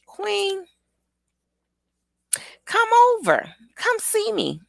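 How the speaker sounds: put-on high small-child voice; imitated little child. Child asking Queen to visit, high imitated voice. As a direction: plead in a high kiddie voice. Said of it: "Addie is my baby".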